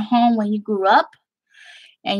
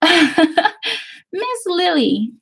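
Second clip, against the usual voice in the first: high, very slow Texas drawl on the compliment; exaggerated Southern gushing drawl; 10 semitones higher, slower than usual. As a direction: stretch it out like a sweet Texas lady. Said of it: "That is a, a true accent".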